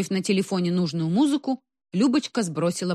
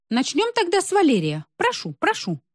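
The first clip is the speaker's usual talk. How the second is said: lifted, higher hostess inviting the next act; 11 semitones higher than usual. A narrator's reading. Hostess calls grandpa Valera to the stage, high and inviting. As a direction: sing out a bright welcome.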